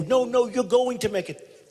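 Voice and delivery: high, slow mimicked reassurance; imitating insistent supporters. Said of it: "They go".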